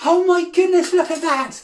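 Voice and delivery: high-pitched, jubilant exclaiming; amazed celebration. Acted. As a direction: cheer an astonishing success. Celebrates catching a magic coin.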